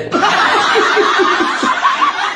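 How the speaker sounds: airy wheezing high laugh; breathy high laugh. Real, not acted. Vlogger mocking politicians' nerve, breathless high laugh.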